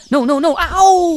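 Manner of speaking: high, slow pleading no's; protesting pain. Acted. Sonic protesting as iodine hits his cut, high and pleading. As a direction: beg her to stop, then yelp.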